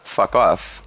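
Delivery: ordinary talk, nothing marked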